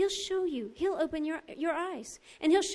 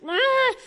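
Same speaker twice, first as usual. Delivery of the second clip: raised, loud "ah"; inner outcry; 9 semitones higher, 8 dB louder than usual. Retold or imitated. Acting out the outcry inside her heart, loud cry.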